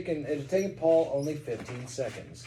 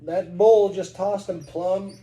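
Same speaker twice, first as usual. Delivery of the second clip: slow Kentucky drawl, louder; Kentucky accent; 3 semitones higher, 6 dB louder, slower than usual. Retold or imitated. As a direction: tell it like a Kentucky cowboy, slowly. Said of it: "speak like a Kentuckian".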